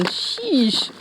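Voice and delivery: normal talking voice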